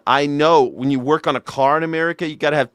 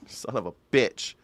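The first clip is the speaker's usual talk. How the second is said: normal talking voice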